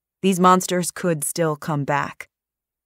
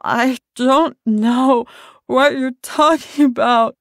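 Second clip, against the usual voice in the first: slow, high, louder wet pleading; garbled, bloodied; 9 semitones higher, 6 dB louder, slower than usual. A narrator's reading. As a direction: speak as if through blood. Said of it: "garbled and wet-sounding".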